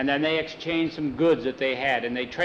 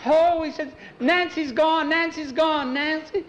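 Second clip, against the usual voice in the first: frantic high scream; 13 semitones higher, slower than usual. A narrator's reading. Mother screaming for her missing daughter, panicked. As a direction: scream for a lost daughter in terror. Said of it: "Missus Miller starts screaming".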